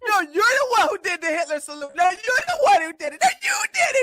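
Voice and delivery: building from brisk to a high shout; rising mock accusation. Mock quarrel, rising to shriek.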